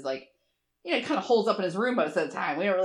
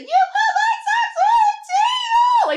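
put-on shrieking kid's wail, far higher and louder; imitated tantrum; 11 dB louder, slower than usual. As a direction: play the upset child screaming, slow. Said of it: "he was just so upset".

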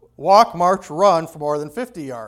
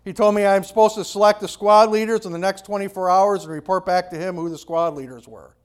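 ordinary talk, nothing marked

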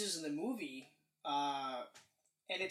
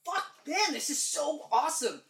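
excited outburst; 7 semitones higher, 4 dB louder than usual. Retold or imitated. Retold excited reaction.

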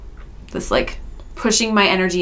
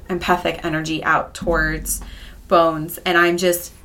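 normal talking voice

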